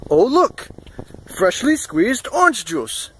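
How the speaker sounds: high-pitched, drawn-out mock presentation; imitating the shop owner. Shop owner presenting fresh juice to guests, slow showy imitation. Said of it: "He said it just like that".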